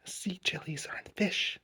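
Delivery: put-on hushed, secretive child's whisper. A narrator's reading. Charlie passing it on to his backpack, whispered. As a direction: repeat it softly under the breath. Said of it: "whispered Charlie".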